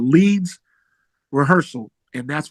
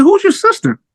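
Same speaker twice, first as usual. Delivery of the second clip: much higher, surprised ask; puzzled question; 4 dB louder than usual. Retold or imitated. To the old man at the door, puzzled higher question. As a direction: caught off guard, asking who she is. Confused at odd remark.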